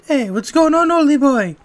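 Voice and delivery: high, loud fairy sidekick; cheeky fairy voice. Acted. Same words, own voice then fairy.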